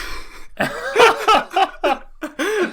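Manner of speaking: shrieking high laugh. Real, not acted. Laughing at his own story.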